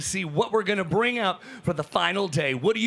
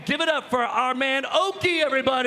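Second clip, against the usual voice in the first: announcer introduction; 4 semitones higher, faster than usual. Real, not acted. Host bringing on the carver, big announcer shout. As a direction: belt out the name to raise applause.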